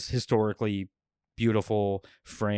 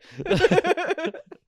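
giggle fit; 4 dB louder than usual. Real, not acted. Laughing at own joke.

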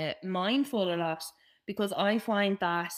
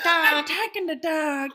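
mock Stifler's mom; 5 semitones higher, 6 dB louder, slower than usual. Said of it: "I'm like Stifler's mom".

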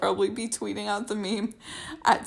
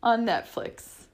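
ordinary talk, nothing marked

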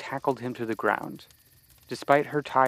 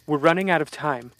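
urgent, louder warning; 4 semitones higher, 5 dB louder than usual. Acted. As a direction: push it out in alarm. Monsters approaching, urgent.

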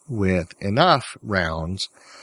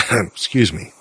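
ordinary delivery

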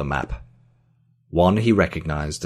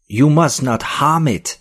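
high, loud, shrill alarm; 10 semitones higher, 7 dB louder than usual. A narrator's reading. Physicist begs Joel not to harm the map, shrill. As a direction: cry it out in a shrill voice. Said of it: "his voice shrill".

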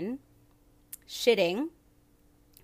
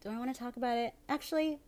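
indecisive; 7 semitones higher, faster than usual. Real, not acted. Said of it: "I'm so indecisive".

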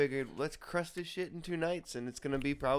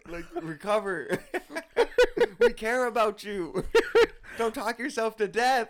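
laughing plea; 9 semitones higher, 7 dB louder than usual. Retold or imitated. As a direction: beg her while cracking up. Quoting himself, laughing.

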